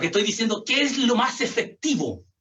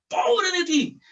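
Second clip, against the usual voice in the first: amenaza de papá; 8 semitones higher, much slower than usual. Retold or imitated. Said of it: "¿se acuerda esa palabrita?".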